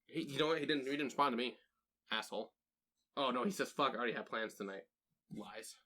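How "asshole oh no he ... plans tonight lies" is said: ordinary talk, nothing marked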